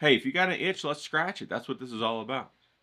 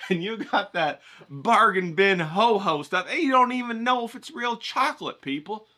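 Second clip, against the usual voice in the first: scolding mirror self; 6 semitones higher than usual. Retold or imitated. To himself in the mirror after a Ho-Ho binge, a scolding sneer. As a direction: sneer at your own reflection. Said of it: "and you're like".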